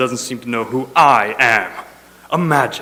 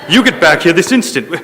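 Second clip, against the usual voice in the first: angry call; 5 semitones higher, 5 dB louder than usual. Acted. Boss yelling at departing technician.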